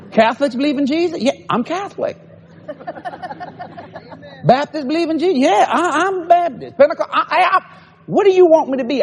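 playful high-pitched mock questions; mock eager questioner. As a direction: tease the crowd by playing the curious folks. Preacher mimics people asking his denomination.

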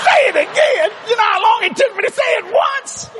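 exasperated disbelief. Real, not acted. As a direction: cry out in laughing disbelief. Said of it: "I'm nervous telling you".

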